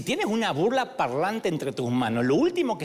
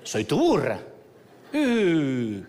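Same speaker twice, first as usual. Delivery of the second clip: put-on Argentine accent. The donkey answers Balaam in a mock Argentine accent. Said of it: "distinguido acento argentino".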